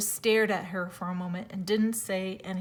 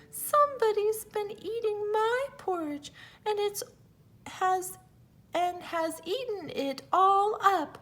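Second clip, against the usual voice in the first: put-on much higher tiny baby bear; little wee bear voice; 10 semitones higher than usual. A narrator's reading. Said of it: "in his little small wee voice".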